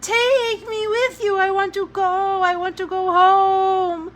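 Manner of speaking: put-on high, loud, slow boy's scream; desperate child screaming to go home; shouting child. A narrator's reading. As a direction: yell it after the departing train. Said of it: "he shouted".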